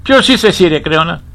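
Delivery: loud, high indignant outburst; defiant challenge. Acted. Professor voicing Antigone's stand against Creon, defiant challenge.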